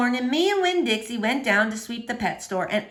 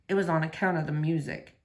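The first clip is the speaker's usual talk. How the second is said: low soft Otis; 7 semitones lower, 6 dB quieter than usual. A narrator's reading. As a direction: lower and softer, a lonely gentle man. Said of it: "he said".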